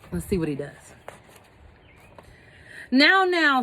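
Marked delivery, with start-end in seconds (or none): 2.9-3.6 lightly voiced bossy bug, higher; a hint of a pushy little bug. A narrator's reading. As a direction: bug scolding the elephant, mildly bossy. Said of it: "said the quagga bug".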